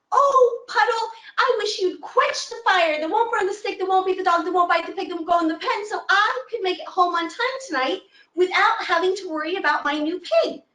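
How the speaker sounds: put-on high old woman voice asking a favor; old woman pleading. Old woman asks the dog to bite her pig.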